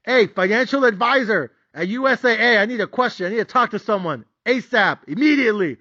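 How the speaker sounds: panicked investor calling his broker; urgent caller. Retold or imitated. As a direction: demand the supervisor in a panic. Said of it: "I was like".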